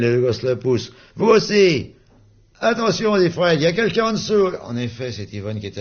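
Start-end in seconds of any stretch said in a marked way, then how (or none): 1.2-3.2 high, loud warning call; playful shout. Acted. Fred crie aux gosses. 3.6-4.5 high, loud warning call; playful shout. Acted. Fred crie aux gosses.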